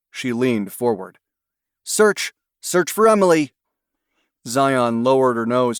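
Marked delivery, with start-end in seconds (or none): 1.8-3.5 sharp high command to the dog; called command. A narrator's reading. Handler sending her dog to search the house, calling out high. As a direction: call it out to send the dog off.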